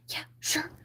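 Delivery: soft unvoiced whisper. Shaken Flounder whispering he's fine. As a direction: breathe it shakily.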